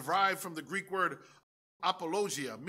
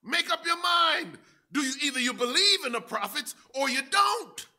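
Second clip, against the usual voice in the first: demanding shout; 9 semitones higher, 7 dB louder than usual. Acted. Preacher as Paul forcing the king to decide, shouting. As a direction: demand an answer now. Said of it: "calls him on the table".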